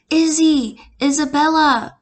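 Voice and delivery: loud, high calls after someone running off; calling out. Acted. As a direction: shout her name across the mall. Parent calling after runaway daughter.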